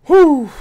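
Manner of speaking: a loud high whew of tension; sharp relieved exhale. Real, not acted. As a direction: blow out the breath, high and loud. Tense moment in fight.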